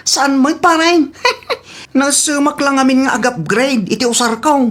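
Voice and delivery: playful high ribbing; teasing buddy voice. Acted. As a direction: lift the voice up to tease your pal. Friend boasting in high voice.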